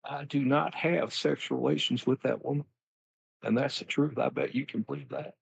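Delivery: Bill denying it on camera, with a wink; Bill Clinton impression. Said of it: "Bill, let's be honest".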